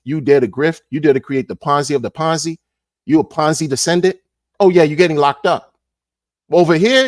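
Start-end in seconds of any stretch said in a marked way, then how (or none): none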